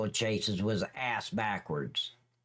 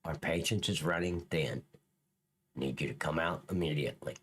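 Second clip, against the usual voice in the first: mocking threat; 9 semitones lower, faster than usual. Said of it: "Ooh, that sounds so threatening".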